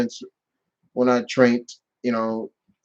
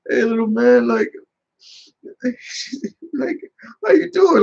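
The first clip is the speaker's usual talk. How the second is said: put-on tender to a child; 10 semitones higher than usual. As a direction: coo gently to the boy.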